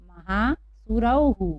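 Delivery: महासुरौहु drawn out slowly, ending in हु; महासुरौहु as ma-haa-su-rau-hu; slow model reading. Shown as a demonstration. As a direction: read महासुरौहु syllable by syllable. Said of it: "इसको हम पढ़ेंगे".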